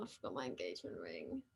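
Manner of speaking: dropped way down to a quick hushed aside. Real, not acted. Host realising mid-livestream she forgot her ring, hushed aside. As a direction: mutter it to yourself, off-mic almost.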